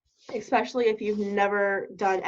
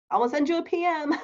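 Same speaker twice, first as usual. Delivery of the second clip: high, fast, laughing send you a P M; mocking robotic reply; 7 semitones higher, faster than usual. Host mocking canned sales replies, laughing. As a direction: laugh through the canned line. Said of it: "You're not gonna say".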